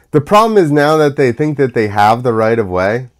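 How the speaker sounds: normal talking voice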